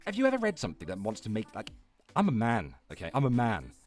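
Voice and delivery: ordinary talk, nothing marked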